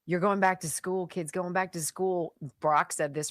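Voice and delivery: normal talking voice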